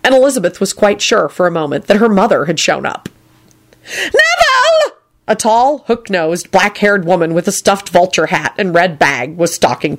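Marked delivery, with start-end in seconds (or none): 4.0-5.0 very high, drawn-out screeching call; deafening screech. A narrator's reading. Gran spotting Neville in the atrium, drawn-out screech. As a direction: screech his name across the hall. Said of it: "there was a deafening screech".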